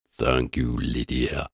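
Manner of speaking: low, soft murmur with a hint of Italian; deep sepulchral murmur. A narrator's reading. The beast thanks Lydia in a low murmur. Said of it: "murmured in a deep sepulchral voice".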